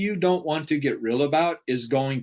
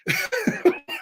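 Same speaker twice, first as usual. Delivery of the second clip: incredulous laugh. Real, not acted. Laughing at mom's suggestion.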